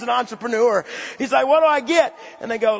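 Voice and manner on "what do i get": eager demand. Retold or imitated. Acts out David bargaining.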